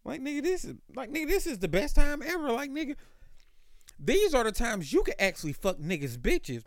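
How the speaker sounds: high, excited pitch as he retells his own words; hyped retelling. Retold or imitated. Retelling his hyped answer to his cousin, high and excited. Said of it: "And I was like".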